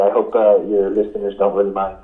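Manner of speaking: stuffy from nasal spray; sniffly, just sprayed nose; after nasal spray. Real, not acted. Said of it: "I'm gonna put some nasal spray".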